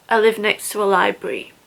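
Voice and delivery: ordinary delivery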